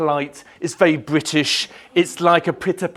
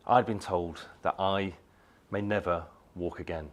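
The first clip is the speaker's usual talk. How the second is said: quiet; 9 semitones lower, 11 dB quieter than usual. Real, not acted. Revealing his past injury.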